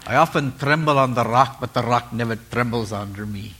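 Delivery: proverb in a put-on Scottish accent. Preacher quoting a proverb to the congregation, Scottish accent. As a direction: recite it like a Scot. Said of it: "I like doing it in that accent".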